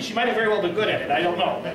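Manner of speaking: normal talking voice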